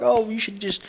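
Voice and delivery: a hint of an online troll; mocking trash talker. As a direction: toss off the insult like a jeering kid. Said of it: "they're like".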